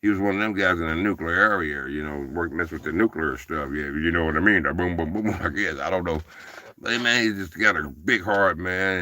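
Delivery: ordinary delivery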